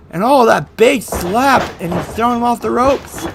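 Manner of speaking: ordinary delivery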